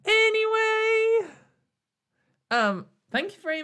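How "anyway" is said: high, slow, drawn-out anyway; a long sung sigh of anyway to close the rant; sing-song anyway. Real, not acted. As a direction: stretch it out high and sing it like a sign-off. Ending the rant on one word.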